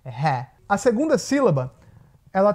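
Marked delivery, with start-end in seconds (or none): 0.1-0.5 "ré" with an open E, low and falling; short-vowel syllable. Shown as a demonstration. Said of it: "ele é um short vowel". ("ré" com E curto e aberto)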